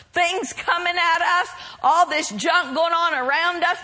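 ordinary talk

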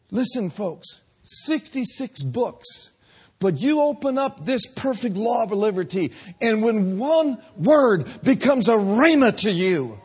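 impassioned pulpit preaching; fiery preaching. Acted. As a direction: preach it full force to the congregation. Preaching at full force.

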